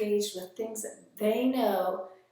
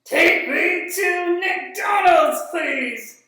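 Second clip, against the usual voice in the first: high, much louder, slow sung shout; silly puppet shout; 9 semitones higher, 14 dB louder, much slower than usual. Acted. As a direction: belt the silly last line like an excited puppet.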